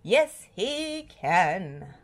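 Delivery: very slow, drawn-out answer; talking to himself. A narrator's reading. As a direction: answer yourself, stretching every word. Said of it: "he said to himself".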